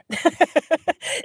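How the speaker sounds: real high laughter; high giggle. Real, not acted. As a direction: burst out giggling. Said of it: "It's hard when you're doing Southern".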